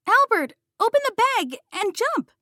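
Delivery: loud, high frantic yell. A narrator's reading. Wanda yelling at Albert as the cat pounces, frantic. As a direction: scream the order to jump. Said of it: "Wanda yelled".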